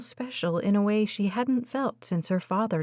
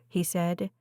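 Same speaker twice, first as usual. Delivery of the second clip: usual speaking voice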